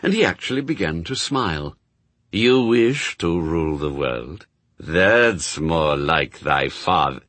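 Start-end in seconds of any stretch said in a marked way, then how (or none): 2.3-7.2 slow, drawn-out demon voice; pleased demon lord. A narrator's reading. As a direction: savour each word as a buzzing demon.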